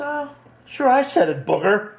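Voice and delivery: high-pitched defiant taunt; giving it to him. Retold or imitated. Young man owning up to the man he insulted, taunting. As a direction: throw the nickname back in his face, pitched high. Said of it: "I give it to him now".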